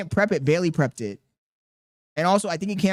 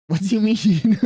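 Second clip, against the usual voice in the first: laughing; 4 semitones higher, 6 dB louder than usual. Real, not acted. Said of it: "Rob, it's funny".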